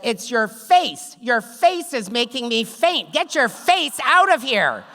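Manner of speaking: high, loud mock outburst, building to the end; exasperated shouting. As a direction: yell it as a hammed-up retold rant. Acted outburst at the doctor.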